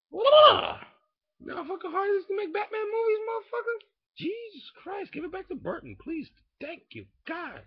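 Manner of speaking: high, loud frantic complaining; exasperated rant. Acted. A comic ranting at the audience about Batman films, high and frantic. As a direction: wail it out like you're at the end of your rope.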